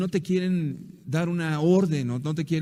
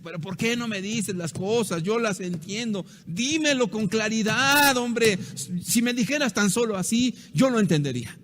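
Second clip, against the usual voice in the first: frustrated husband; 6 semitones higher than usual. Acted. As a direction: press the wife to just say it plainly. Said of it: "quieres forzosamente".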